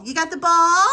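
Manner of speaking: high, loud cheerful question; pet talk. Real, not acted. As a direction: coo at the dog over the ball. Excited at the dog's ball.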